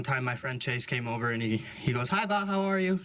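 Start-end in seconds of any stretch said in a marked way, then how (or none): 2.1-3.0 high cheerful greeting. Retold or imitated. Friend voiced greeting grandma.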